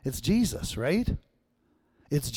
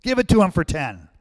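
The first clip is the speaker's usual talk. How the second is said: much higher, louder call that eases off; shouted heckle; 7 semitones higher, 5 dB louder than usual. Retold or imitated. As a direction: heckle the auctioneer to hurry up. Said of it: "another guy shouted out".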